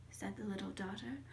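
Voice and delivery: plain reading voice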